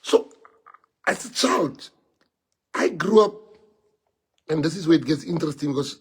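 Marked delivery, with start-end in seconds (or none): none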